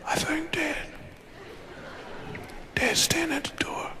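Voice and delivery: urgent hushed whisper. Acted. Boy warns brother dad's at the door, whispering. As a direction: whisper a warning. Warning that dad is there.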